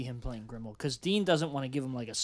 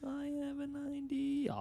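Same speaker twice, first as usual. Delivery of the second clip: cartoon catchphrase impression; 14 semitones higher, 3 dB quieter than usual. Co-host butting in on a casting idea, high cartoon catchphrase. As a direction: stretch it out high and silly.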